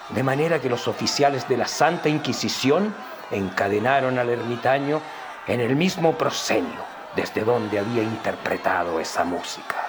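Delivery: ordinary delivery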